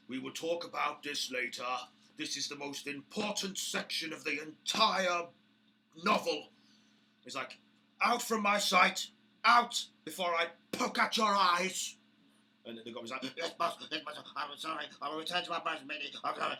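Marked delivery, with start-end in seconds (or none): 0.1-6.5 loud, higher affronted orc voice; pompous orc boss. Acted. Orc boss dictating memoirs, scolding the goblin loudly. 8.0-12.0 very loud, high roaring dismissal; furious orc shout. Acted. Orc boss chasing the goblin out, furious shout. 13.4-16.6 high, hurried grovelling goblin; scared goblin. Acted. Goblin backing out before the orc boss, hurried high apology.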